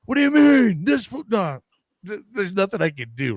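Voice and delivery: high, incredulous start that fades; arguing back with his partner, indignant; protesting, then trailing off; mock protest. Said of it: "I'm like".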